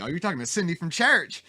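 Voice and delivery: high, loud put-on Southern accent; teasing Southern mimicry. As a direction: tease with an exaggerated Southern accent. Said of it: "I could always tell when".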